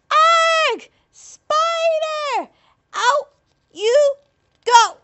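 high furious shriek; berserk rage. Acted. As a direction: yell it out of the house. Family throws the spider out again.

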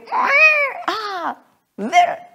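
put-on high crying baby wail. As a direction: wail like a baby left under a tree.

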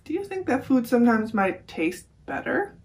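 plain reading voice

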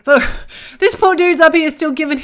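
loud, high, on the edge of laughter; amused outburst. Real, not acted. Gamer pitying the man still giving his speech, laughing. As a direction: crack up while reporting it.

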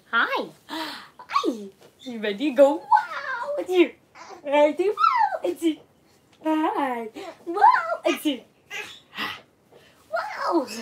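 squealed high wows and delighted gasps; loud, high-pitched baby-game excitement; peekaboo baby talk. Acted. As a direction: play peekaboo with a baby, all wonder.

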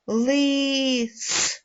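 Лис stretched out, ending in a long hissing с; drawn-out final с. Shown as a demonstration. Teacher sounding out Лис for children, drawn-out hissing с. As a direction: say Лис slowly, letting the last с hiss on. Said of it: "Цей звук шипить, свистить".

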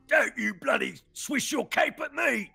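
high, loud angry goblin shout; big goblin yelling at the swordswoman. Acted. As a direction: bellow at the swishing cape. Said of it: "a large one sort of yells".

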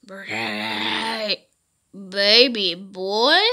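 low, very slow zombie voice; zombie mother. Pretend. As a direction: drawl it deep, slowly questioning. Zombie mother answers the son.

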